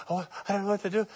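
gasp, then a quiet breathless panic; mimicking a frantic caller; panicked gasp. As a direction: act out the frightened caller's gasp. Frantic phone call retold.

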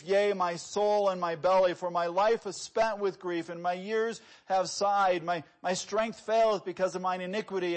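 preacher reading the Bible aloud with fervour; fervent scripture reading. Acted. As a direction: proclaim the verses with full force.

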